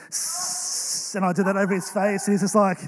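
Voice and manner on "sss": hissing snake sound. Retold or imitated. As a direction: hiss like a snake. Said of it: "Mister Snake".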